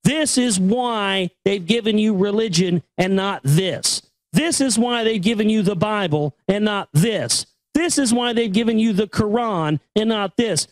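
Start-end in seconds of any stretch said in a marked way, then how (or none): none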